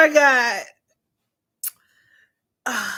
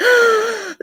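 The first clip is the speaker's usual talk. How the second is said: sharp high gasp; 11 semitones higher, 3 dB louder than usual. Real, not acted. Sudden gasp.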